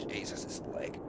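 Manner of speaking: breathless whisper through leg pain. Acted. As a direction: hiss out the hurt under the breath.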